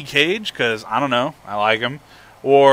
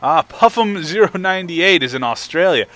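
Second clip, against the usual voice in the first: normal talking voice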